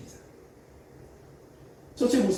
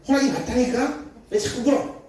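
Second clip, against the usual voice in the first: annoyed mother; 10 semitones higher, 5 dB louder than usual. Retold or imitated. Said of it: "막 짜증내기 시작했어요".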